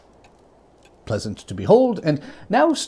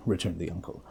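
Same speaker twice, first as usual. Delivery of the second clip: plain reading voice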